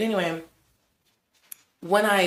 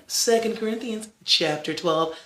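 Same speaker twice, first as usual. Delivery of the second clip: ordinary delivery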